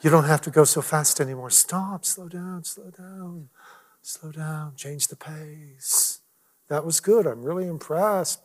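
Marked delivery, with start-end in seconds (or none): 0.0-6.2 soft, slowing, fading almost to a whisper; gentle inner voice. Retold or imitated. God's voice telling the pastor to slow down, hushed and slow. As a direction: let the voice of God hush him, slow down. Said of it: "I heard the voice saying".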